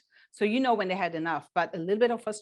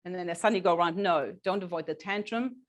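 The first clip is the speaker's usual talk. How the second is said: ordinary delivery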